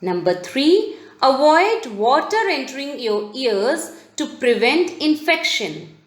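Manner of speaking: normal talking voice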